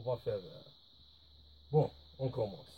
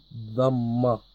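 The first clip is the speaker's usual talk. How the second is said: normal talking voice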